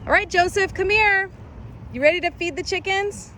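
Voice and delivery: high sing-song mom voice. Real, not acted. Mother calls son, high voice.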